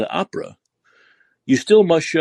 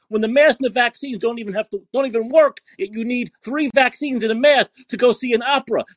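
real angry rant; 7 semitones higher than usual. Real, not acted. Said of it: "that's why I'm so mad".

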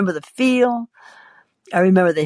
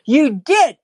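higher, louder "you did"; accusing retort; 6 semitones higher, 5 dB louder than usual. Acted. Woman bickering with her husband, accusing. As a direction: throw it back accusingly.

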